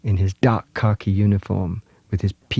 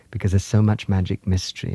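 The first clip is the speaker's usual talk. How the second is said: ordinary talk, nothing marked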